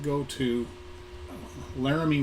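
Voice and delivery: normal talking voice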